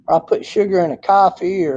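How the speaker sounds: ordinary delivery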